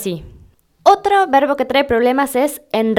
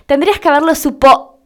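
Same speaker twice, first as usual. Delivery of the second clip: higher, louder, then stop mid-word at "supo-"; cut off abruptly; 4 semitones higher, 4 dB louder than usual. Retold or imitated. Catching her own slip mid-argument, cut off abruptly. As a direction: break off dead on "supo-". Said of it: "me corté a mí misma en seco".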